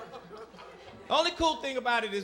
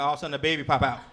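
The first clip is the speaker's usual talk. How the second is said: usual speaking voice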